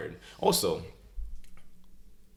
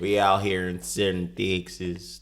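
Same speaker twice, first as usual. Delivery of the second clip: slow, badly faked Texas drawl; terrible Texas accent; 4 dB louder, slower than usual. Co-host showing off for the podcast, in a terrible Texas accent. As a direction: ham up a poor Texas accent. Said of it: "That's a terrible se-, Texas accent".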